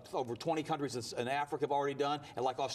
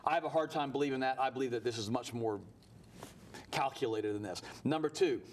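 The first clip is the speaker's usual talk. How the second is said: ordinary talk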